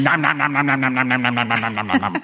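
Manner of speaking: fading nom-nom eating sounds; chomping nom noises. Acted. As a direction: chomp greedily. Kittens cramming sandwiches.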